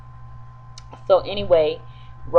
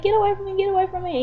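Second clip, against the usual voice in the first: imitated screaming; 13 semitones higher, much faster than usual. Woman flees him through the house, high mock screaming. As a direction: mimic panicked cries without full volume. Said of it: "she's screaming and hollering".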